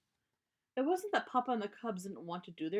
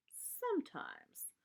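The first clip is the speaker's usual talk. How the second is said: high, slow wry aside; ironic aside; 9 semitones higher, 3 dB quieter, much slower than usual. Acted. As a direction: deliver the dry punchline. Ironic punchline.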